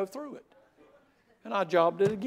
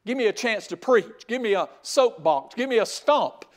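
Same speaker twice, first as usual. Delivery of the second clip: higher, louder repeated give me; preacher demanding a platform, growing louder; rising preaching; 5 semitones higher, 6 dB louder than usual. Real, not acted. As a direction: build the list with fervour. Building preacher's cry.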